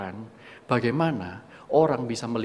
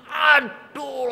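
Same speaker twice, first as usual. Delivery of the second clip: ouch; 12 semitones higher, 4 dB louder than usual. Retold or imitated. Stepping on Lego again.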